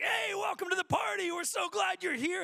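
high, loud party greeting; very loud woman. Retold or imitated. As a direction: holler a welcome down the aisle like a loud passenger. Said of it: "who's very loud".

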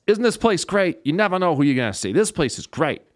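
imitating a high-pitched, upbeat emcee; club host. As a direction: hype the room like an emcee. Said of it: "The host comes out and says".

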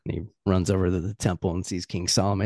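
usual speaking voice

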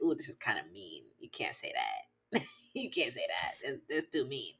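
low, quiet talking to oneself; self-censoring aside. Retold or imitated. Telling oneself not to tweet it, low self-censoring aside. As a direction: voice drops to a soft, low self-reproach.

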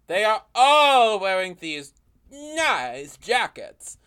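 exaggerated slow stress on "all" and "nice"; hammed-up emphasis. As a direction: stretch "all" and "nice" with big theatrical swoops. Resumes script, hamming up repeated line.

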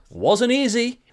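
high, loud dry quip; called-down reply. Retold or imitated. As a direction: shout back a deadpan answer. Old man answers from above.

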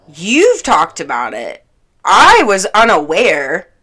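YOU'VE and I punched loud, slowly; pointed retort. Retold or imitated. Correcting him that only they discussed it, pointed and loud. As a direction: lean hard on "You've" and "I" to contrast them.

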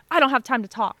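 abrupt, punchy dismissal; brusque grandma. Retold or imitated. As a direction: imitate a grandma cutting the call short. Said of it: "as soon as my mom would be like".

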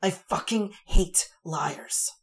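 slow, loud venomous whisper; hissed hatred, hard and loud; fierce whisper. A narrator's reading. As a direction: spit it out in a harsh whisper. Angry woman after choke-slam.

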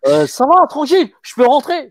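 high, loud mock punter at the door; cocky club-goer. Former doorman imitates a guy asking to enter, high and loud. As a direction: imitate a would-be customer pushing to get in. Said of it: "il faisait".

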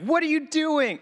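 high, loud imitated sports-fan yell; mock yell. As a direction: yell at the players like her. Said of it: "She would start yelling at them".

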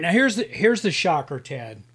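ordinary talk

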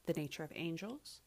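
ordinary talk, nothing marked

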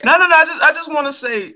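high, loud no no no; loud, high protest to get a word in. Real, not acted. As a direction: push back loudly and high. Cutting in to defend himself.

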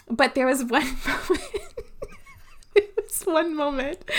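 giggling through the words, pitch up; trying not to crack up; laughing. Real, not acted. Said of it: "and I'm laughing now".

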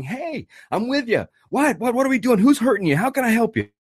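mirror their panic, jump right in beside them; high-pitched urgent rush to help; excited urgency. Shown as a demonstration. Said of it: "very excited and urgent at the same time as they are".

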